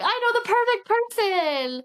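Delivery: high-pitched, sing-out enthusiasm; retold eager excitement. Reggie offering a friend as familiar, eagerly excited. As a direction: burst in with the perfect idea, hammed up.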